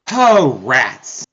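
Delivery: hammed-up sigh of letdown; sighing disappointment. Player voices her character just short of a rifle, sighing disappointment. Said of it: "she just sighs and she's like".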